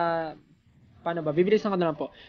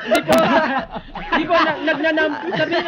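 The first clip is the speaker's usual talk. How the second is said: laughing; 8 semitones higher, 6 dB louder than usual. Real, not acted. Caught laughing mid-answer.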